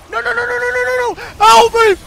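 rising high, desperate pleading; panicked no. Real, not acted. Gamer about to die, panicking high and loud.

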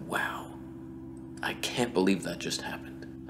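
breathy awestruck whisper; awed whisper. Retold or imitated. Narrator mocking his own staged miracle, awestruck whisper. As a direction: whisper it like you witnessed a miracle. Mock awe after fake thunder.

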